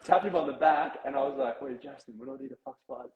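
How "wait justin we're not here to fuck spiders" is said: hushed aside. Retold or imitated. Tapping a teammate on the back before Battleships, hushed. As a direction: keep it way down, almost under your breath.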